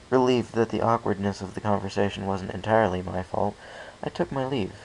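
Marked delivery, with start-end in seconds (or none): none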